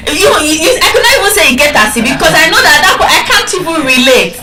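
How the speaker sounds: giggling at her own clumsy Pidgin; laughing. Real, not acted. Trainer laughing about failing at Pidgin, laughing.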